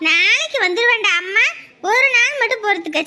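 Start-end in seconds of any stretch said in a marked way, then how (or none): none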